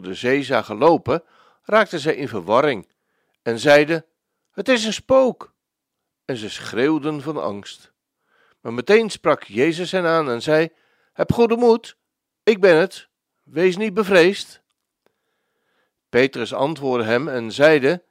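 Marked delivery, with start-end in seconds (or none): 4.5-5.5 high, startled shout of fear; scared disciples crying out; frightened cry. Acted. 11.1-14.6 raised, carrying voice calling out comfort; reassuring call, high and firm; calling reassurance. Acted. Jesus calls to frightened disciples.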